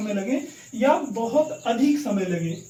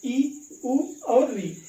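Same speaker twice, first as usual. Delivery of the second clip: slow listing; much slower than usual. Shown as a demonstration. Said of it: "ध्यान रखेंगे अ".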